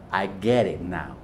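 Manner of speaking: put-on high, heavy Italian-accented aunt. Comedian imitating his Italian aunt teasing him, heavy Italian accent. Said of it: "she was like".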